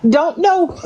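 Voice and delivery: laughing. Real, not acted. Laughing, high pitch.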